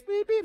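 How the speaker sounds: high, loud electronic beeps; imitated detector beeps. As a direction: sound like a detector going off. Detector going off over perfect house.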